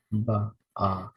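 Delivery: Abba broken into "Ba, ah"; teacher breaking a word into sounds; syllables split. Shown as a demonstration. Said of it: "Next word is".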